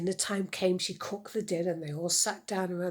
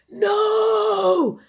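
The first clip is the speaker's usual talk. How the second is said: much higher, louder shriek of panic; shouted No; 16 dB louder than usual. Acted. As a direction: shout it in horror. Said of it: "shouted Clef".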